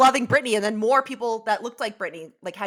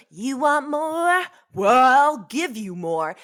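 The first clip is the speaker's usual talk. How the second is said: light [name] impression, slow and drawn out; imitating [name]; 2 semitones higher, much slower than usual. Explaining where the tagline came from, mild [name] imitation. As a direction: stretch it like the pop star, lightly. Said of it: "So in it, she goes".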